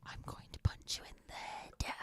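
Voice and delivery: whispered, voiceless thought; whispered inner threat. Retold or imitated. Mom whispering the thought she'd never say aloud. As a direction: breathe the threat under your breath.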